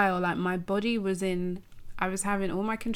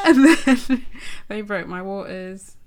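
louder, slow, laughing through the words; can't hold the giggles as you tell it; 9 dB louder, slower than usual. Real, not acted. Laughing while retelling the waters breaking.

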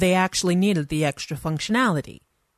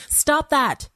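sharp high cry to stop him; 9 semitones higher than usual. Acted. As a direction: cry out much higher, alarmed. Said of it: "cried Linda".

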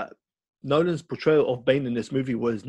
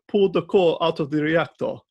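higher-pitched mock villain in an odd accent; Bane; 4 semitones higher, 4 dB louder than usual. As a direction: put on a strange, theatrical accent. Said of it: "What kind of accent is that?".

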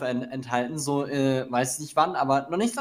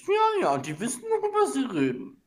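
snobby music expert; 7 semitones higher than usual. Retold or imitated. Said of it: "die sagen".

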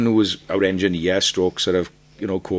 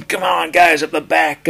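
higher, louder hammed-up rock shout; mock frontman shout; 9 semitones higher, 7 dB louder than usual. Mocking the clichéd rock frontman at the crowd: mock shout. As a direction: parody the clichéd crowd hype. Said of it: "and all this nonsense".

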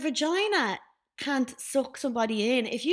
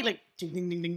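ordinary talk, nothing marked

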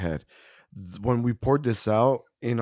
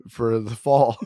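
a touch louder, playful griping; mock rant at brewery; 4 semitones higher, 5 dB louder than usual. Co-host mock-scolding the brewery over old beer, half-joking. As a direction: pretend to chew out the brewer.

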